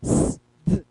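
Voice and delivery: S hissed and D released alone; isolated sounds of S and D; bare consonant sounds. Shown as a demonstration. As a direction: sound out S and D without their names. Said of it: "eu ficar falando".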